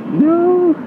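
high, far, cute little no; a sweet, sing-song no; loving no. Retold or imitated. Fiancée answering his bike wish with a sweet no. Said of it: "In the most loving way possible".

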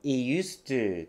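"It used to" with the T of "It" dropped; slow demo of "It used to", no T before the y sound. Shown as a demonstration. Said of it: "Yの音の前のTが落ちてますね".

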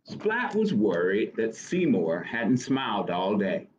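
ordinary delivery

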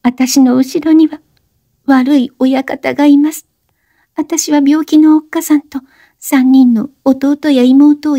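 put-on high young woman's voice, urgent plea; breathless high-pitched plea; Oshino, pleading. A narrator's reading. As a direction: beg him to stop talking. Oshino confessing.

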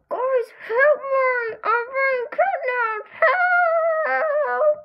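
high, loud, slow panicked cry for help; a kidnapped kid wailing for help, pitched high and loud. Acted. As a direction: scream to your friends that you're being taken. Victim calls out for rescue.

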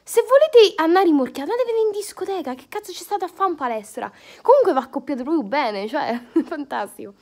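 ordinary talk, nothing marked

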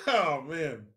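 ordinary talk

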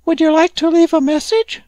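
very high, squeaky little animal voice; high-pitched cartoon rodent; Manila Chinchilla. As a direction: pipe it up like a tiny creature. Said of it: "said the Manila Chinchilla".

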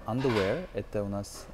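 usual speaking voice

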